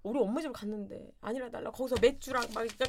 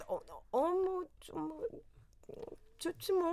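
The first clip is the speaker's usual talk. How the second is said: stammering liar; 7 semitones higher, 5 dB quieter, slower than usual. Retold or imitated. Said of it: "말을 더듬는 건가".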